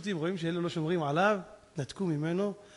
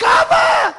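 very high, loud drawn-out shout; mimicked cry out; 14 dB louder, much slower than usual. Elephant cries to the Father in heaven, shouting. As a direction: call out for Father at full voice. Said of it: "הוא צעק".

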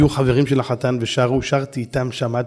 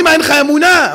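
much louder, higher rebuke; rebuking salesman; 13 semitones higher, 10 dB louder than usual. Retold or imitated. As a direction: challenge his faith loudly. (נזיפה רמה בקול גבוה)

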